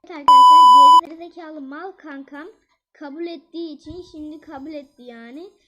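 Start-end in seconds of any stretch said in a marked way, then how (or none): 0.0-1.1 very loud, high shout; screaming announcement; sudden yell. Real, not acted. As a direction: blast it out at full volume.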